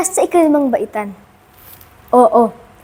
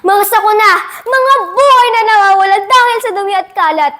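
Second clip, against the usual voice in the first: raised, urgent oratorical cry; declaimed alarm, pitched up; impassioned alarm; 6 semitones higher than usual. Acted. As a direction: build the list of disasters high and intense. Listing disasters in a speech.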